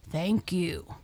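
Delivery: sincere thanks in the narrator's own range; humble. A narrator's reading. Freed lion thanking the mouse, humbly. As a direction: give thanks humbly, without any roar. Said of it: "he said humbly".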